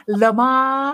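"Lama" with a lifted, end-stressed flourish; fancy pronunciation. Shown as a demonstration. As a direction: say "Lama" fancily, stressing the last syllable. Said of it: "we try to be fancy".

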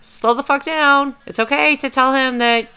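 high, loud self-scolding that eases off toward the end; inner voice. Acted. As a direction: rein yourself in with a high, urgent pep talk. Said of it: "my brain wasn't stopping myself and going".

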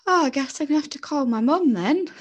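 high, put-upon resignation; reluctant sigh. Retold or imitated. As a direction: say it like a dreaded chore. Dreading calling mum.

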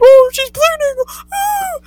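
high, loud, slow wailing mom; mock panicked mom. Imitating her mom shrieking that she's bleeding. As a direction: ham up the panicking mother.

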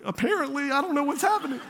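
a giddy, bewildered laugh-along; baffled laughing reply. Retold or imitated. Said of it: "And I was like".

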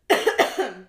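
loud hacking cough; coughing fit. Real, not acted. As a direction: cough hard, mid-sentence. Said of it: "sorry, I'm coughing so much".

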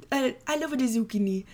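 playful put-on French accent; hammed-up French tourist; mock French accent. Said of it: "oh, maybe I can".

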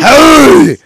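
very high, loud "hey"; sudden high-pitched holler; shouted interjection. Real, not acted. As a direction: yell over the others to cut in. Cutting in on crosstalk, jokingly.

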